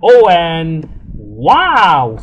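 loud, drawn-out wow; excited wow. Real, not acted. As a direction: stretch out the wow, delighted. Game pops up achievement.

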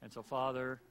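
loud, earnest pastor's prayer that opens strong and settles; heartfelt pulpit prayer, loud at the start; closing prayer. Acted. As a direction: pray over the congregation as you close the service.

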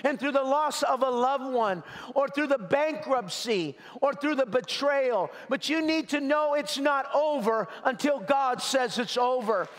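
high, ringing preacher's cry; fervent preaching. Acted. Pastor urging the hurting congregation, fervent preaching. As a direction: preach it out to lift the room. Preacher builds to full force.

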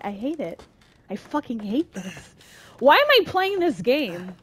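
normal talking voice

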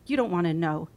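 ordinary delivery

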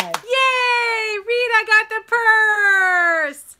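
slow, high, loud cheer; drawn-out happy cheer; excited cheer. Real, not acted. Celebrates Rita winning the auction.

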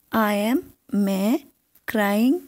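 usual speaking voice

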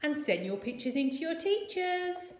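bright cheery. Acted. Cheerful sign-off to children.

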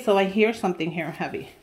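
usual speaking voice

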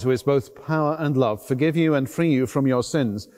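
ordinary talk, nothing marked

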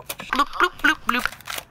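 high, silly popping noises; bloop sound effect. Pretend. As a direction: make cartoon blooping sounds. Imitating the gun's firing noise.

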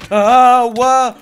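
loud, high yelp of shock; sudden shriek breaking into a stammer; startled scream. Real, not acted. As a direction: scream as if caught off guard. Startled by sudden game event.

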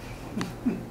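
quiet unvoiced laugh under the breath; a sly madman's chuckle; soft breathy chuckle. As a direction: private giggle at the dead. Mad narrator laughs mid-tale.

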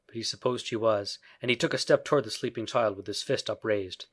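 usual speaking voice

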